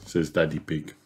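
ordinary delivery, nothing marked

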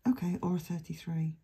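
ordinary talk, nothing marked

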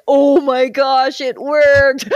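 loud, high retold excitement; thrilled disbelief. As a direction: burst out, amazed at your own success. Retold thrill that the trick worked.

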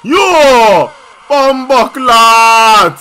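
loud, long-drawn cry of astonishment; stretched-out shouted reaction; loud exclamation. Real, not acted. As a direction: shout it out, stretching the last word. Excited reaction to match clip.

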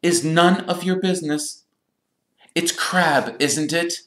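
high-pitched affronted formality; stiff. A narrator's reading. Percy refusing to answer Crabbe, stiff. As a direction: lift the voice and rebuff them primly. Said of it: "he said stiffly".

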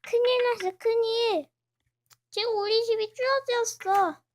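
alarmed yell about the house; panicked alarm. Acted. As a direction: cry out that the house is crushed. Character panics over broken house.

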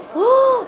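sharp high gasp of amazement; impressed gasp. Acted. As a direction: gasp as if wowed by the room. Gasps at spacious pantry.

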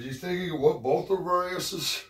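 a hint of a cocky young son; imitating his son.

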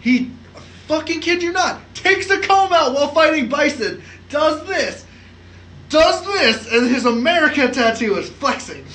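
high, loud, worked-up storytelling; can't believe it, telling it big; excited. Real, not acted. Excited retelling of absurd scene.

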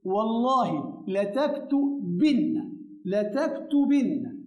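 لتكتبن said slowly twice with a held doubled n; slow model phrase. Shown as a demonstration. Teacher modelling the feminine form slowly for students. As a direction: dwell on the nna of لتكتبن. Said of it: "هقول إيه؟".

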